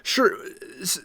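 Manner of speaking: normal talking voice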